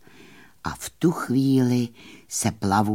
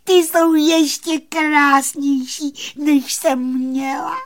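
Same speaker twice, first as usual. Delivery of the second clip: delighted; 5 dB louder than usual. Acted. Fairy rejoices over her new hair, high and delighted. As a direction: savour the joy, high and bright. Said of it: "vykřikla šťastně plavůňka".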